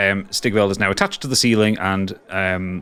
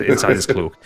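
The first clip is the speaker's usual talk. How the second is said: usual speaking voice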